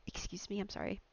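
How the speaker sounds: ordinary talk, nothing marked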